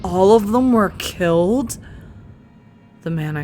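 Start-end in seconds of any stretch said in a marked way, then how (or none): 0.0-1.8 high, loud disbelieving question; shocked. Acted. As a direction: cry it out in disbelief. Disbelief at the slaughter.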